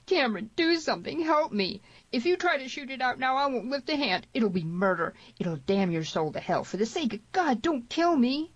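high-pitched, desperate begging; groaning plea. A narrator's reading. Bleeding Tierney begs Cameron for his life, groaning and pleading. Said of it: "groaned Tierney".

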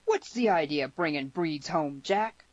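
plain reading voice

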